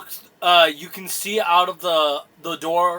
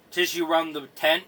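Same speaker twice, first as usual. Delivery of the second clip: ordinary talk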